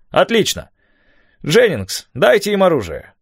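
high-pitched, emphatic order; brisk command. A narrator's reading. Mayor orders Jennings to arm the boys, commanding. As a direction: give orders to the whole party.

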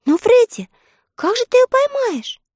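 very high, put-on girl's voice; little sister's voice. A narrator's reading. Sister asking brother about catching fish, high girlish question.

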